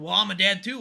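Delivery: loud mocking imitation of single moms; mock boast. Mocks single moms' Father's Day claim.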